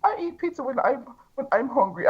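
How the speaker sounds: high mocking impression of Paul. [name] mimicking Paul's reply about pizza, high mocking voice. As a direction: pitch way up, a prissy put-on voice. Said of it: "That's a good impression".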